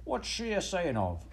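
high, slow Cockney puzzlement; acted working-class London cry; crowd's cry. A narrator's reading. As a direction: call out as a baffled street crowd. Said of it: "cried a dozen voices".

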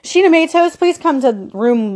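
lightly imitated public-address call, starting high; mock paging announcement, trailing into filler; loudspeaker announcement. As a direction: call out a name like a hospital announcer. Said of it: "over the loudspeaker says".

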